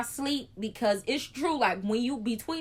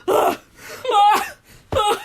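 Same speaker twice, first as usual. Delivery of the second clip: loud, high panicked ughs; panicked gasps; 10 semitones higher, 9 dB louder than usual. Retold or imitated. Patient panics mid-contraction with loud, high gasping ughs. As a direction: gasp out in panic.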